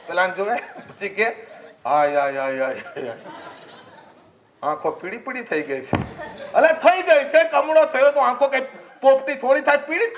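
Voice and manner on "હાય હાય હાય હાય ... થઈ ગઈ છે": low, hammed-up wailing over the patient; a mock mourning tone, lower; mock lament. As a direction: groan pity at the sick man. Gloomy visitor laments.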